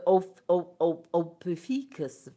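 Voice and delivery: ordinary talk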